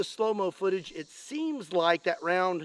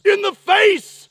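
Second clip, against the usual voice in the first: high, loud and drawn out; excited shout; 10 semitones higher, 9 dB louder, slower than usual. Real, not acted. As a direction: relish every word, triumphant. Gleeful shot at zombie's face.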